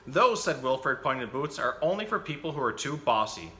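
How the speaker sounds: put-on lively picture-book reading for children; animated bedtime-story reader; story narration. A narrator's reading. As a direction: read the story out with bouncy, emphatic phrasing.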